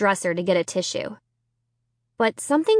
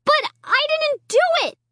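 high desperate wail. A narrator's reading. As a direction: wail the denial. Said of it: "I wailed".

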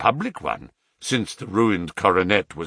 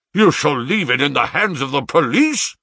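loud, high, grief-stricken threat; half mad with rage; 5 semitones higher, 5 dB louder than usual. A narrator's reading. Father threatens son with the police, half-mad cry. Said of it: "I cried, half mad with grief and rage".

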